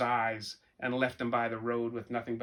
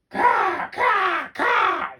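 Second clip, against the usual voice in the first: shrill loud caw, much higher; raven cawing; 11 dB louder, much slower than usual. Acted. Angry raven yelling down from the oak tree. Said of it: "yelled a raven".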